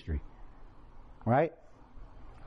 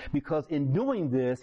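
usual speaking voice

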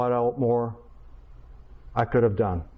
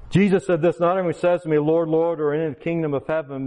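forceful preaching; 3 semitones higher, 6 dB louder than usual. Real, not acted. Preaching scripture at full force.

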